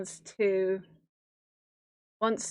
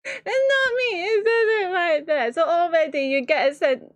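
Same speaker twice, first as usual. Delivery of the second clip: laughing; 8 semitones higher, 4 dB louder than usual. Real, not acted. Laughing at the blurb's joke.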